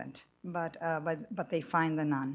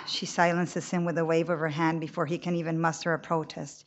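ordinary delivery, nothing marked

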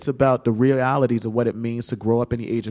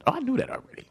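lifted high, light and offhand; mom's breezy high voice; 3 dB quieter, much faster than usual. Retold or imitated. Mother telling her son she already knew, high and offhand. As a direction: toss it off like you knew all along. Mom's surprising reply to coming out.

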